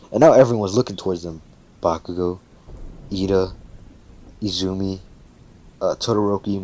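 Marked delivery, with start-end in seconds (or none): none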